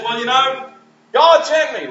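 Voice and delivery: lightly voiced Moses announcing his mission; Moses to Pharaoh.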